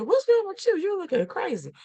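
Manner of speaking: high mock-incredulous husband; husband's retort. Husband teasing his frustrated wife, mock baffled. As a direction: toss it back at her, baffled. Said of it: "So my husband said".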